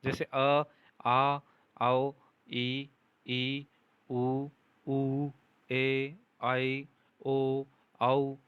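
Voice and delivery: अ, आ, ई, उ, ए, ओ, औ each voiced loud and clear; teacher modelling अ आ ई उ ए ओ औ aloud; vowels sounded out. Shown as a demonstration. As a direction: sound out every vowel so pupils can repeat. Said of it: "उनका उच्चारण भी साथ के साथ कीजिए".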